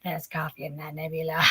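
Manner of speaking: low, soft impression of a starship captain; Kate Mulgrew. Guest recalling her hoarse sound, low captain impression. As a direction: drop deep and quiet like a husky captain. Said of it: "like Kate Mulgrew".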